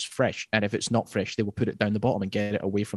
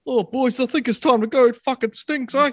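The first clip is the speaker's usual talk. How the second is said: high mock warrior voice; 13 semitones higher, 4 dB louder than usual. Voicing a fed-up warrior.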